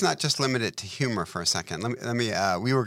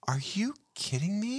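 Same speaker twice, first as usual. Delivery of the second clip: whispered disbelief, high and soft; unvoiced whisper of shock; incredulous whisper; 7 semitones higher, 7 dB quieter than usual. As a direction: hiss it under your breath in disbelief. Looked at him like.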